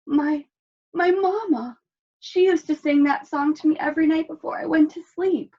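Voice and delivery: high, softened, nostalgic; tender wolf. A narrator's reading. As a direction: melt at the memory.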